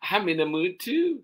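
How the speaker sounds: put-on high child's grumble, moderate volume; complaining child. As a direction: grumble up high without shouting. Same complaint, second reading.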